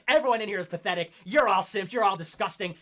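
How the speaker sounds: fast, loud indignant rant; imitating himself raging in the live chat; mock ranting at chat. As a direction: scold the whole chat at once. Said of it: "I'm in the live chat saying".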